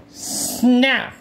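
snap sound. Acted. Jaws snapping shut.

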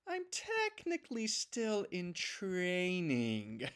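slow, small embarrassed voice; sheepish confession. Acted. Noob telling Chance he's a trainee, slow sheepish voice. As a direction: admit it reluctantly.

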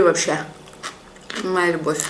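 talking through a bite of pickle; chewy, muffled crunchy words; mouth full, chewing. Real, not acted. Seen 4: bites pickle at 0.8s, then chews while speaking.

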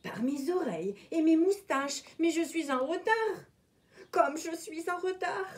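a hint of a hurried, fussing rabbit; lightly voiced rabbit fretting about being late; fretful rabbit. A narrator's reading. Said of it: "en murmurant".

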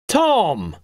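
high call of a name; crying out. A narrator's reading. Bald man greeting Tom on the beach, crying out. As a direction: cry out in greeting, without full volume. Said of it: "cried the bald man".

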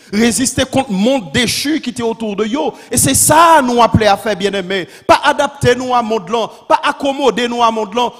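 loud, high, impassioned sermon; full-force preaching. Real, not acted. Preacher declaring holy anger against injustice, full-force preaching. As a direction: hammer out each declaration like a rallying cry.

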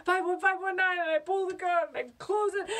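high, breathless-sounding panicked telling; urgent, high-pitched narration; frantic escape. Acted. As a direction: race through each step in panic.

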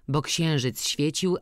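plain reading voice

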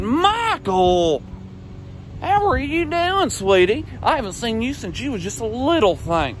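put-on high, drawn-out old lady greeting; imitated old-lady fussing, stretched and high; gushing old woman. Voices an old woman gushing at him.

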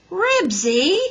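loud, high, drawn-out wail; plaintive high cry to a dog. A narrator's reading. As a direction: wail the dog's name pleadingly. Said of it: "wailed Henry".

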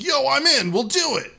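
loud, high Mudkip cheering; excited Mudkip. Acted. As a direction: shout it like an eager hero. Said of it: "Mudkip's like".

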